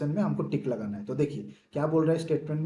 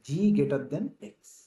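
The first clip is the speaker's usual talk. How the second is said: very slow reading; much slower than usual. Shown as a demonstration. Teacher demonstrating the reading, very slow. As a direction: stretch "G greater than X" out word by word. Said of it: "कैसे पढ़ूंगा".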